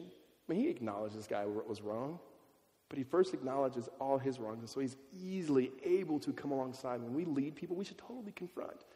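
ordinary delivery

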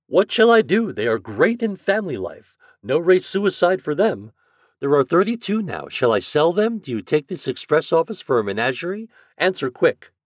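high, emphatic urgent appeal; exasperated express agent. A narrator's reading. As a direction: read it as a fed-up agent demanding an answer. Flannery's second letter.

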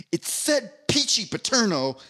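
high, slow, indignant snap of the name; acted-out annoyance, drawing out the flavor; indignant angry retort. Cafeteria lady scolding the kid, indignant and slow. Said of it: "like, angry".

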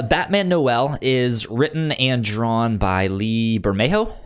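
normal talking voice

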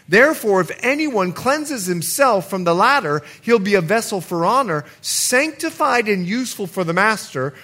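high, loud preaching; impassioned sermon peak; full-force preaching. Acted. Preaching to church.

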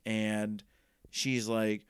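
ordinary talk

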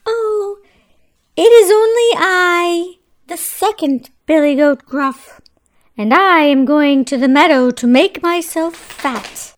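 a bigger, bolder goat; not so small voice. Acted. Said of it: "his voice was not so small".

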